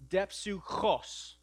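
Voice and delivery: depsuchos, slow and carefully spelled out; slow clear dictionary pronunciation. Shown as a demonstration. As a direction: say depsuchos syllable by syllable. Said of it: "This word is pronounced depsuchos".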